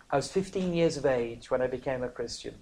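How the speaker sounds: ordinary talk, nothing marked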